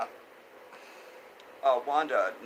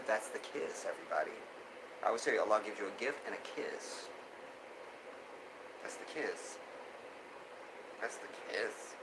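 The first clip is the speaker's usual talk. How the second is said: tender; 4 semitones lower, 12 dB quieter than usual. Real, not acted. Tender soft blessing remark.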